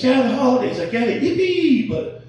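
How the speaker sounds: usual speaking voice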